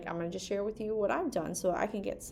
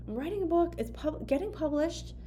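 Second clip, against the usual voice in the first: higher, bright stress on book; excited emphasis; 8 semitones higher than usual. Real, not acted. Sharing her big news with viewers, excitedly. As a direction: announce it with a thrill. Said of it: "I, I'm writing a book".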